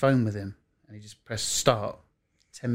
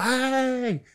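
much higher, bright mock-cheery greeting; 11 semitones higher, much slower than usual. Reporter greeting the manager in a high cheery voice. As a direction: sing out a cheery hello. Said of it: "I was like".

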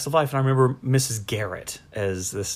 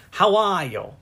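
put-on upper-class New England accent, pitched high; Katharine Hepburn; 7 semitones higher, 4 dB louder than usual. Showing how an old film star would talk, high upper-class New England accent. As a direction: imitate an old film star's R-less New England "are". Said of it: "like Katharine Hepburn".

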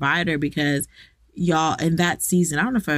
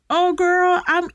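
put-on high, bubbly girlfriend voice, much higher; gushing girlfriend; 13 semitones higher, 3 dB louder, slower than usual. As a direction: gush like a friend offering clothes. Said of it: "friends would literally".

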